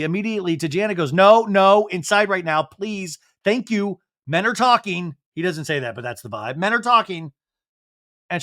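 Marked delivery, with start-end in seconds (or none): 1.1-5.2 higher, firm mock order to go inside; brusque dismissal. Jesse shooing Janet back inside, brusque dismissal. Retold order shushing a wife away. 6.6-7.3 higher-pitched mock dismissal; brusque dismissal. Husband telling his wife men are talking, brusque dismissal.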